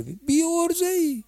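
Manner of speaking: mock him in a high teasing voice; mocking scold. Preacher mocking the congregant's excuses in a high teasing jeer.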